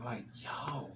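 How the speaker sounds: whispered, breathless yo; awed whisper. Real, not acted. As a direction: hush it in awe. Overwhelmed moment in the story.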